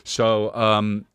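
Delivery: ordinary delivery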